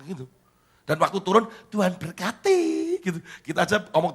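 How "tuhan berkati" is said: high, loud, lifting at the end; imitated bright-eyed child's send-off; cheery child's blessing. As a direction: call the blessing out like a fearless kid. Child's bold blessing to driver.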